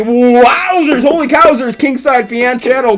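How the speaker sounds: excited commentator. Acted. Excited call.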